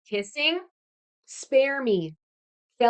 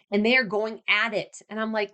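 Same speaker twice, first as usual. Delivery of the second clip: normal talking voice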